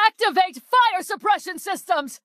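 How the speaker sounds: high, alarmed command; urgent command. Acted. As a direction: cry out an urgent order, pitched high.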